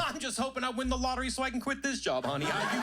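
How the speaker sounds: high, sassy stewardess reply. Retold or imitated. Stewardess answering a passenger, high and sassy. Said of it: "And she goes".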